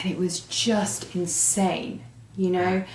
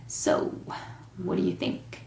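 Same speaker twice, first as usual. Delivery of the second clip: softly imitated American man's accent; 8 dB quieter than usual. Man holding the meeting checks in with newcomer, mild imitated American accent. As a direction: lightly put on an American accent. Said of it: "this is an American accent".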